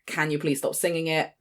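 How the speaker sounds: ordinary talk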